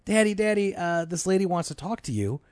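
a hint of an eager child's high voice; imitating child. Kid runs in to fetch dad, lightly imitated child voice.

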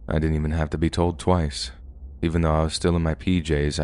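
ordinary delivery

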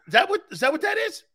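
high, quick imitation of a friend; mock cocky buddy. Buddy unimpressed after an easy lift, high mock brag. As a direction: rattle it off way up high.

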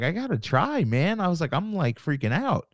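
pitched well up, pleading and frantic; desperate plea. Retold or imitated. Promoter begging the venue manager for the date, frantic and high. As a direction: beg like you're freaking out.

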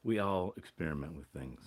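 a hint of a wise TV father; sitcom dad. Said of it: "eighties sitcom dads".

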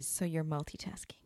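softly whispered tease; whispering. Real, not acted. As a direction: whisper it under the breath. Teasing aside, unvoiced.

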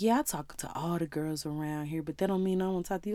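sleazy smooth talker. Retold or imitated. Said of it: "He was sleazy".

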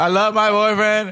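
high, slow mock girlfriend voice; girl voice. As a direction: sweetly play the innocent girlfriend. Mocking girl who cheats.

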